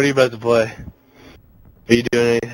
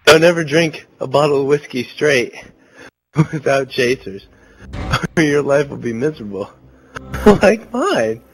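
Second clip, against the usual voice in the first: helpless real giggling; laughing; 3 semitones higher, 3 dB louder than usual. Real, not acted. Drunk, laughing at own misery.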